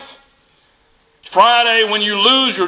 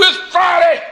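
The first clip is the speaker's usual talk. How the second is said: high, forceful preacher's cry; voice of death; 6 semitones higher than usual. Acted. Death declaring to the listener, high forceful cry. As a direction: cry it out sharply like a pronouncement. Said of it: "the voice of death says to you".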